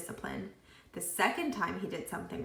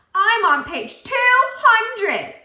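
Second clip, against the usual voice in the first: slow, high, very loud boastful shout; Joy boasts loudly across the classroom; 14 semitones higher, 16 dB louder, much slower than usual. A narrator's reading. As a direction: yell it out to show off. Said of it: "Joy shouted".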